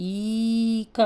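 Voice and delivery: long ఈ stretched out in ఈక; long vowel demo. Shown as a demonstration. Teacher showing kids the long vowel in ఈక. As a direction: say ఈక with a long, drawn ఈ. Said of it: "చూడండి, ఇది first letter".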